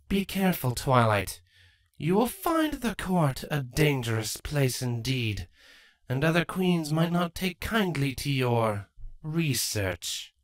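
lightly voiced queen, strained smile; Queen Chrysalis. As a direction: greet them, hiding anger.

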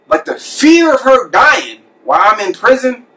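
voice breaking high. Real, not acted. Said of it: "Sorry I'm so emotional".

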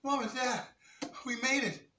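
put-on excited kids. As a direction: call out proudly.

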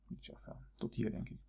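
ordinary talk, nothing marked

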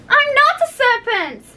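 high, indignant protest; offended outburst, pitched far above narration. A narrator's reading. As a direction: deny it hotly in a high voice. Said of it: "said Alice indignantly".

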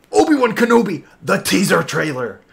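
high, loud title announcement; hyped announcement. Real, not acted. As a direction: announce it like a thrilled fan. Hyped announcement of reaction video.

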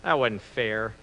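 low, soft voice to himself; acted quiet second thoughts; regretful thinking. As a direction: think aloud remorsefully, hushed and low. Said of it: "sits down and starts to think".

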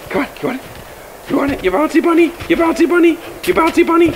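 playful squeal teasing the dog with a stick. Real, not acted. High baby talk to dog.

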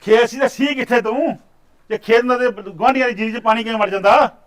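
angry scolding. Acted. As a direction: tear into the lazy worker. Master scolding farmhand.

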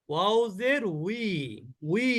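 "উই" with rounded lips, a w glide; correct w sound. Shown as a demonstration. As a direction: say "উই" like English we. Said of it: "আসলে ওয়াও জের বিও না". ("উই" ওষ্ঠ্য ওয়া দিয়ে)

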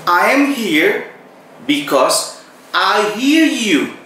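very slow, word-by-word "I am here because I hear you"; slow repeat. Shown as a demonstration. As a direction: spell it out slowly for learners. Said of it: "Saya ulangi". (diulang dengan sangat pelan dan jelas)

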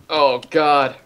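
ordinary delivery

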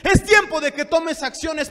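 loud, building full-force preaching. Real, not acted. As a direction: build to a high, urgent pulpit shout. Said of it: "Perdón que levante la voz". (prédica a voz en grito, cada vez más intensa)

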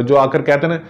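normal talking voice

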